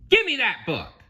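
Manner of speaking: high, loud demanding bird; seagull squawk. Acted. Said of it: "the seagull squawked".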